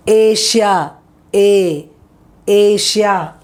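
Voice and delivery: Asia with a clear long ay sound; pronunciation demo. Shown as a demonstration. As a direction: drill the word Asia, stressing the ay. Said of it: "Now you saw the sounds".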